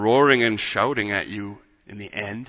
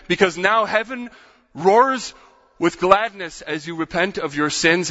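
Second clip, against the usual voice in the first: rising higher and louder with each refrain; building fervent preaching; 7 semitones higher, 6 dB louder than usual. Real, not acted. Preacher building his sermon to its climax, swelling into a fervent shout. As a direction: preach it up to a soaring peak.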